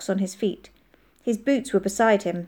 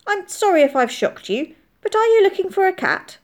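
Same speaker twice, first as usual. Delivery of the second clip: higher, apologetic call; calling down politely; 12 semitones higher, 5 dB louder than usual. A narrator's reading. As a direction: call down politely to strangers below. Hidden man calls down to children.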